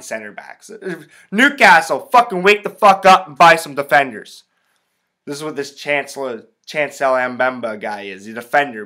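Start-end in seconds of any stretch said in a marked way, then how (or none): 1.3-4.4 loud, higher-pitched demand; exasperated call-out. Real, not acted. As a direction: yell at your club to wake up. Exhorting the club to buy defenders.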